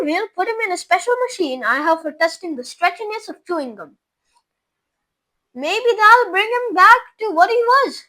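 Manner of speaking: playful inventor explaining; whimsical Wonka. A narrator's reading. Wonka explains his stretching machine, playfully.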